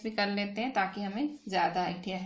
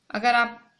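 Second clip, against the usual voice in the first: ordinary delivery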